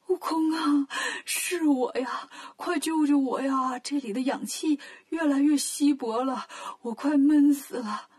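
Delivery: slow, desperate monk calling for rescue; trapped master begging to be saved; pleading Tang Seng. Acted. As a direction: plead weakly from the stone box.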